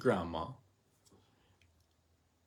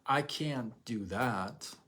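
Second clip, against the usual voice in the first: slow, defeated excuse-making; mildly whiny excuse; much slower than usual. Retold or imitated. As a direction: drag it out like giving up. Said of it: "You could say".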